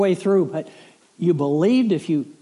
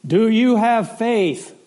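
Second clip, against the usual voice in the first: slow, louder, higher solemn question from above; voice from heaven; 5 semitones higher, 5 dB louder, slower than usual. Retold or imitated. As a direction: ask it like the voice of God, drawn out. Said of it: "a voice answered from the heavens".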